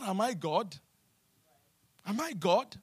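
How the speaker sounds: normal talking voice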